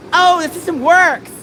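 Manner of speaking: high, slow smug sneer; mocking smug. Streamer mocking people who defend the system, slow smug sneer. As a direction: mimic them with smug mockery. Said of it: "People are like".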